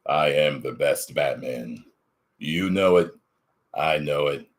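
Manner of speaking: deep, low Batman growl; Kevin Conroy Batman. Acted. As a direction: drop into a gravel Batman voice. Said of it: "Kevin Conroy impression".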